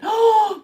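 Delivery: sharp, loud gasp of surprise; high gasp on spotting someone; excited gasp. Real, not acted. Spots Matilda in the live chat.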